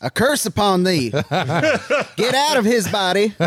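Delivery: high, mock exorcist laughing through it; hammed-up curse, cast it out laughing; laying a curse. Mock curse after coughing fit.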